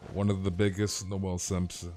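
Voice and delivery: ordinary talk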